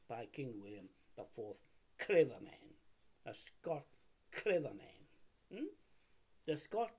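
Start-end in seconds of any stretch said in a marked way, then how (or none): 2.0-2.7 loud emphasis. Real, not acted. Repeats the phrase for emphasis. 4.3-5.5 slow emphasis. Real, not acted. Repeats the phrase for emphasis.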